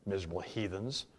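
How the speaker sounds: low, soft grumble to himself; muttered. A narrator's reading. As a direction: mutter it under your breath, disgruntled. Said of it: "he muttered to himself".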